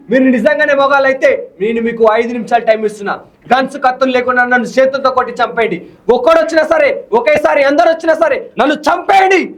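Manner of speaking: fiery hero dialogue performed for the interviewer; hero punch dialogue. Shown as a demonstration. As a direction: spit out the challenge like a mass film hero. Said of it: "ఇప్పుడు కూర్చొని చెప్పాలంటే".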